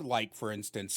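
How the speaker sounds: ordinary talk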